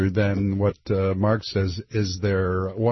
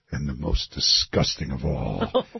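low, softer would-be Irish priest; Irish accent sounding Scottish; 9 semitones lower, 6 dB quieter than usual. Acted. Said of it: "your Irish accent is Scottish".

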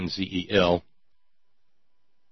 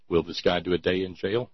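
ordinary talk, nothing marked

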